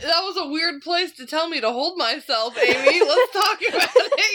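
words pushed out through laughter; giggling through the sentence; laughing while talking. Real, not acted. Laughing hard at co-host's joke.

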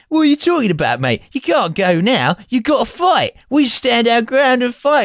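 very high, hammed-up Cockney urging; mock Cockney hooligan. Hooligan urging the newcomer to fight, exaggerated Cockney.

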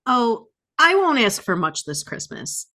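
ordinary talk

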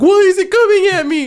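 high, loud, hurried mock alarm; mock panic. Host retells Zach as the snake comes at him, mock panic. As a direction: imitate a panicked man, high and fast.

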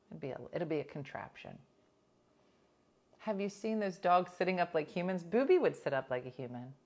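normal talking voice

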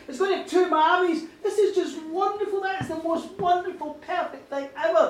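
loud, high, fast gush of delight; overjoyed. Acted. Woman thrilled to learn the father, loud and high. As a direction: blurt out the good news with glee.

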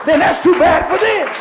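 full-force preaching. Real, not acted. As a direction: drive the point home like a fired-up preacher.

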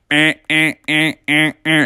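repeated monotone alarm buzzes; evenly spaced beeping noises; imitated alarm clock. Alarm goes off at four.

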